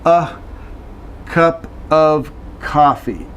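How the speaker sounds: slow, careful cup of coffee; slow demo. Shown as a demonstration. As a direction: stretch out cup of coffee for learners. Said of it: "So here we go".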